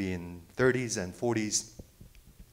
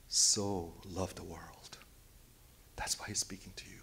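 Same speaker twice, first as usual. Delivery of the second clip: tender whisper; 5 semitones lower, 10 dB quieter than usual. Real, not acted. Preacher telling why Jesus speaks harshly, whispered. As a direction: let it sink in quietly.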